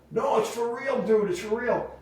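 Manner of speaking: high pleading insistence; high mock of an urgent caller; insistent caller. Caller insists it's real.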